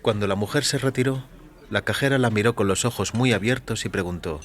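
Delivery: plain reading voice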